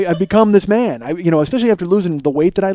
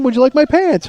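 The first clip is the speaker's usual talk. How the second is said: "Would you like my pants?" said much higher and insistent; indignant replay; 8 semitones higher, 3 dB louder than usual. Retold or imitated. Arguing with his wife, repeating "Would you like my pants?" high and insistent. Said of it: "nobody would say that".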